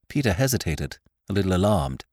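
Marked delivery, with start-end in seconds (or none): none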